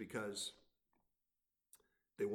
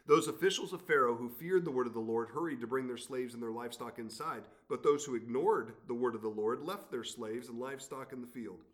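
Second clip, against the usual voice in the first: lifted, measured Bible reading; scripture reading; 2 semitones higher, 9 dB louder than usual. Acted. Pastor reads Exodus to the congregation, raised reading voice. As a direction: read God's words to Pharaoh with weight.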